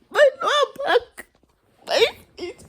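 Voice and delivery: very high fake sob over aches; hammed-up tearful moan; mock wailing. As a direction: wail it in pretend agony.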